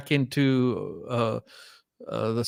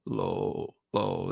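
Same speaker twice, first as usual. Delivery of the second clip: low fry; 6 semitones lower, 9 dB quieter than usual. Shown as a demonstration. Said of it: "in the low".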